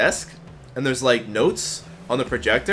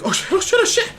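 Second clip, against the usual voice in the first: mock panic. Scared student reacts.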